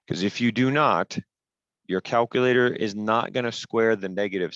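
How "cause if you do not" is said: normal talking voice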